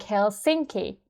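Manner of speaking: "Helsinki" American style, stress moved onto "sin"; American-accented "hel-SINK-ee"; American pronunciation. Shown as a demonstration. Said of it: "Americans might say something like".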